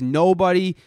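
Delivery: a hint of a stern father laying down the law; stern father quote. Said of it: "his father tells him, he says".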